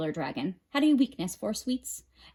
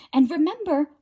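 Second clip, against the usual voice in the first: warning; 7 semitones higher, 6 dB louder, faster than usual. A narrator's reading. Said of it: "Rhea warned".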